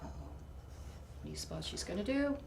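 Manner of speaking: ordinary delivery, nothing marked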